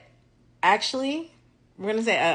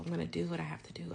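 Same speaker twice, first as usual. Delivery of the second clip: a fast, unvoiced whisper; whispered repeat; 14 dB quieter, much faster than usual. Calming herself before the exam, whispered fast.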